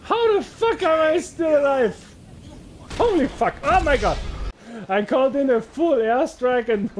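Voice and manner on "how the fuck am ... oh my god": stunned disbelief. Real, not acted. Shocked survivor after airstrike.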